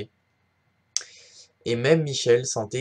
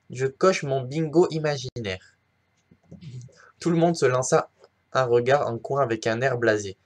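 usual speaking voice

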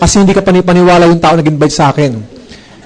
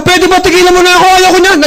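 much higher, shouted in exasperation; retold yell; 12 semitones higher than usual. Boss yelling at his persistent clerk to leave him alone. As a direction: yell to make him stop pestering. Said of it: "Sinigawan ko siya".

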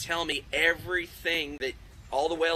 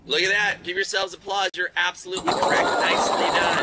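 loud cheer; 3 semitones higher, 7 dB louder than usual. Real, not acted. Host cheers a correct answer.

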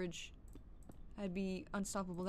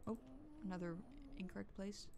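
usual speaking voice

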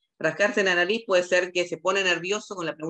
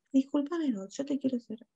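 soft, higher-pitched polite question; softened questioning; 8 semitones higher, 5 dB quieter than usual. Shown as a demonstration. Interviewer demonstrating a question for a witness, softened. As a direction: ease the question in gently and quietly. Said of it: "le hacemos la pregunta más despacio".

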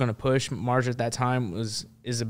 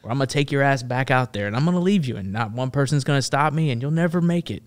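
louder, forceful ultimatum; acted-out threat, pitch climbing; retold threat; 4 semitones higher, 6 dB louder than usual. Marine threatening to abandon the reporter, in a forceful retold ultimatum.

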